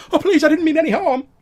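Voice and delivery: Ellen Brooks pleading. Acted. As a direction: beg not to be hurt, caught out. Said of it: "a familiar female voice say".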